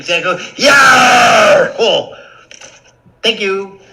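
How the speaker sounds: loud high cheer; joyful shout. Acted. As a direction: whoop with relief.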